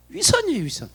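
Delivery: ordinary delivery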